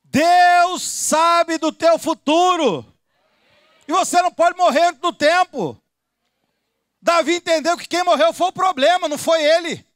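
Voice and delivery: high, loud pulpit shout; fiery sermon climax, pitched high and loud; full-force preaching. Real, not acted. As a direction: preach it out at the top of your voice.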